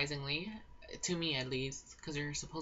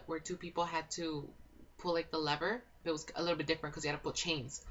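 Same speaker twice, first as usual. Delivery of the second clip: normal talking voice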